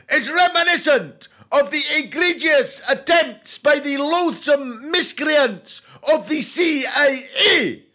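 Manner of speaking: high, loud, grandiose tirade; ranting dictator figure. Acted. Pompous despot denouncing a dance judge, loud ranting.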